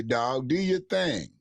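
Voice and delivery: ordinary talk, nothing marked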